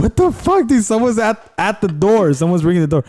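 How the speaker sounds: high, alarmed retelling of panic; panicked. Retold or imitated. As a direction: sound freaked out by a stranger. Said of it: "And then I was like".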